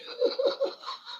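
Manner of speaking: real squeaky burst of giggling; a quick shrill cackle; high laugh. Real, not acted. Streamer proud he recorded the proof, high giggle. Laughing at his own boast.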